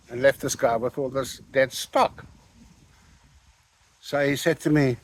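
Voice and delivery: ordinary talk, nothing marked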